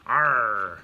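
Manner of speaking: loud, drawn-out pirate growl. Shown as a demonstration. Parent showing kids silly reading voices, loud pirate voice. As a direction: give a big pirate "arr". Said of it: "like, a pirate voice".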